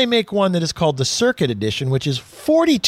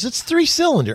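proud boast; 10 semitones higher than usual. Retold or imitated. Friend boasting about the engine.

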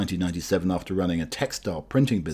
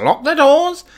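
parrot squawk; 7 dB louder than usual. Acted. Speaker's call quoted as parrot.

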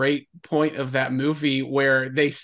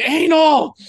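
higher, louder joking yell at the screen; mock yell; 10 semitones higher, 7 dB louder than usual. Heckling the movie with friends, a mock yell. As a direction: shout it at the TV like a heckler. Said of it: "I'm yelling".

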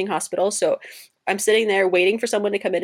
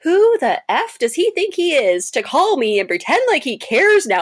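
much higher and louder, fed-up outrage; indignant rant; 8 semitones higher, 4 dB louder than usual. Retold or imitated. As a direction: vent at the ex, rising to a loud peak. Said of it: "I was like".

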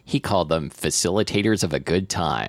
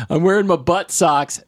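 put-on 1 little boy voice; 9 semitones higher, 4 dB louder than usual. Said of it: "the nine-year-old ... said".

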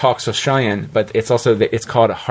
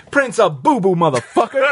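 higher-pitched, punchy actor impression; Samuel L. Jackson; 8 semitones higher than usual. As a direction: deliver it as an emphatic star cameo. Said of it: "Samuel L. Jackson doing it".

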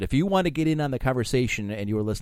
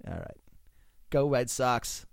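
bad Boston accent; 2 semitones lower, 7 dB quieter than usual. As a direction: throw in a softer fake Boston accent. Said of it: "I'll do bad accents all day".

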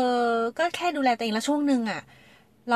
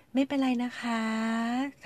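soft, gentle reassurance with drawn-out endings; hushed, kindly bedside tone; soothing doctor voice; 2 semitones higher, 5 dB quieter, much slower than usual. Retold or imitated. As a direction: imitate a doctor calming a patient softly. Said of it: "ทำเสียงนี้แหละ".

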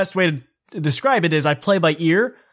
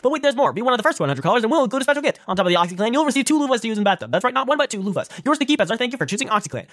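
fast, high infomercial disclaimer read; 7 semitones higher, much faster than usual. Pretend. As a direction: rattle off the fine print like a pitchman. Here's how to order.

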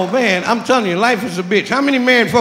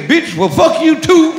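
mock angry retort; 6 semitones higher, 5 dB louder, slower than usual. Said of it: "the moment you say".

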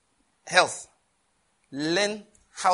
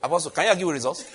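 laughing; 4 dB louder, much faster than usual. Real, not acted. Teasing question to apostle.

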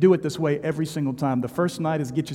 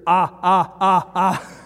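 mock tongues; 3 semitones higher, 9 dB louder, much slower than usual.

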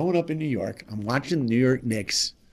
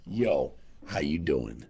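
much lower, slow laid-back "Yo, how you doing?"; Michael Jordan impression; 8 semitones lower, 4 dB quieter, slower than usual. Michael Jordan greeting a fourteen-year-old fan, low and relaxed. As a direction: lean down and greet a starstruck kid, cool and deep. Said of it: "he looks down at me and says, quote".

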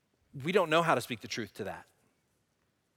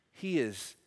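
ordinary talk

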